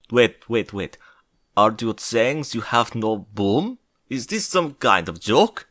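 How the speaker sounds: slow, put-on Russian accent. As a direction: play an incredulous Russian leader with a thick accent. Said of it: "said the Prime Minister of Russia".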